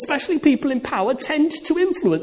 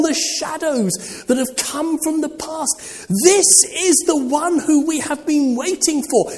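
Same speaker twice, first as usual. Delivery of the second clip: fervent sermon climax; preaching at full force; 6 semitones higher than usual. Acted. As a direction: proclaim it like a crescendo.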